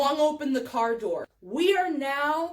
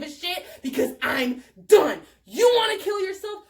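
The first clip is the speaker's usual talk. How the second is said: furious rant building to a shout; losing it. Retold or imitated. Teller completely losing it at friend, angry rant rising to shouting. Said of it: "I just completely lost it".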